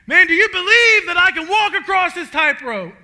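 rally the crowd like a showman; showman's call. Retold or imitated. Blondin calls to the crowd.

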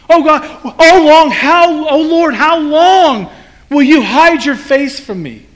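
a hunted man crying out to God; pleading, drawn-out psalm cry; desperate lament. Retold or imitated. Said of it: "He says".

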